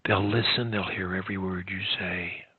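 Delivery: soft, low, intimate delivery; hushed voice. Shown as a demonstration. Coach modelling a speech to professors, hushed. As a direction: keep it down so they lean in. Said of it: "even in a whisper".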